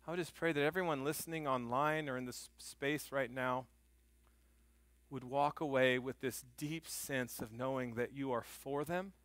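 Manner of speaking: soft, earnest closing prayer. Acted. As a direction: pray it quietly over the congregation. Pastor leads closing prayer.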